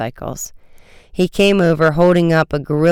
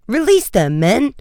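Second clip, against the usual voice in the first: higher-pitched officer's order; firm, raised call to subordinates; command; 8 semitones higher than usual. A narrator's reading. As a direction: order the men to let go. Lieutenant orders his men.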